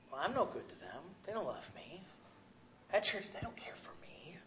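soft, hushed devil whispering lies; devil's whisper. Acted. Preacher voicing the devil to a doubting church member, hushed whisper. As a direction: breathe it like a tempting whisper. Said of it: "you know who says that? The devil says that".

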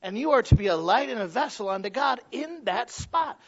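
normal talking voice

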